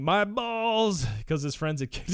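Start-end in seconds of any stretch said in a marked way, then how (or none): 0.0-1.0 yelped out high and loud; retold pained yell, half-acted; mock scream. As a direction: cry out like you just got kicked in the nuts.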